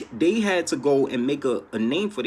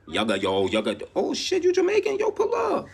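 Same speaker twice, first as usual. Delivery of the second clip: higher, excited greeting; playful high mock invite; excited mock welcome; 12 semitones higher than usual. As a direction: call them in with hype. Acting out welcoming newcomers.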